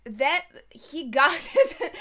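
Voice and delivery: loud, breaking into a laugh mid-sentence; real amusement bursting through the words. Real, not acted. Viewer reacting to what he got immediately, laughing.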